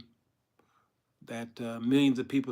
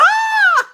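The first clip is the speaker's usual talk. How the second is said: very high, loud comic scream; mock terrified scream; 16 dB louder than usual. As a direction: scream as if suddenly attacked. Samson screams when attacked.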